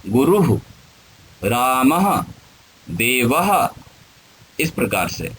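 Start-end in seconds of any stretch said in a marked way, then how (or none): 0.1-3.7 गुरुः, रामः, देवः ending in echoed "hu", "ha", "ha"; visarga pronunciation. Shown as a demonstration. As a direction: sound the final ः of गुरुः, रामः, देवः as h plus vowel. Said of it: "उसका उच्चारण होता है ह". (गुरुः, रामः, देवः में विसर्ग का स्पष्ट "ह" उच्चारण)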